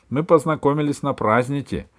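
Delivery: put-on high-pitched child talking to mum; lively daughter asking for food; chirpy girl. A narrator's reading. Girl chatters to her mother.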